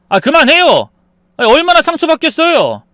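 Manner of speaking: high, loud, fed-up protest; angry interruption. Acted. Son telling mother to stop hurting wife, angry protest. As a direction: cut the argument off sharply.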